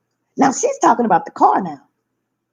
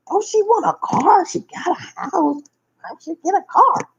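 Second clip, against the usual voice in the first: high retold quote; 9 semitones higher than usual. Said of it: "I'm like".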